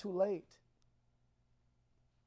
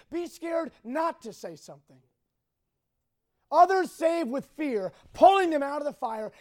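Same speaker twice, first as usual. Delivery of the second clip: raised, emphatic exhortation to the congregation; forceful preaching; 3 semitones higher, 4 dB louder than usual. Real, not acted. Preacher challenging teenagers to step up, forceful exhortation. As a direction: preach it hard, driving the key words.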